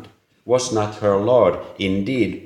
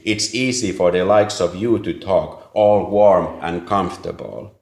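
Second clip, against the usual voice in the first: plain reading voice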